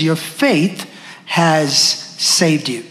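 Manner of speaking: your faith has saved you, slow and falling low on saved; slow emphatic phrase. Shown as a demonstration. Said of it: "Underline that phrase".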